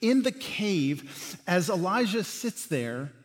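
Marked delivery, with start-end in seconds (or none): none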